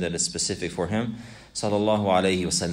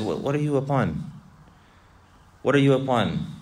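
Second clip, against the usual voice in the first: higher-pitched imagined questioner; questioner's challenge; 5 semitones higher than usual. Acted. Someone asking the believer what path he follows, questioning. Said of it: "If somebody wanted to, they said".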